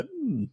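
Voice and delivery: high, smug hum. Retold or imitated. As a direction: hum like you've already won. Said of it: "you go".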